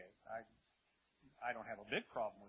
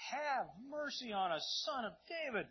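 drawn-out, higher-pitched plea; crying out; 9 semitones higher, 3 dB louder than usual. Acted. Two blind men call after Jesus, crying out. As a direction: implore slowly with a high, reaching call. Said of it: "crying out".

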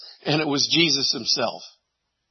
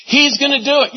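ordinary delivery

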